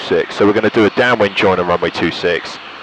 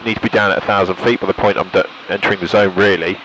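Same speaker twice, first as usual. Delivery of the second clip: ordinary talk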